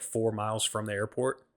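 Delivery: ordinary talk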